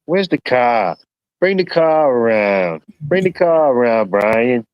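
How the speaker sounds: slow, hammed-up Boston accent, calling for the car; a shaky try at a Boston accent; attempted Boston accent. Joking to his chat friend, calling for the car in a mock Boston accent. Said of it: "that probably ain't Boston".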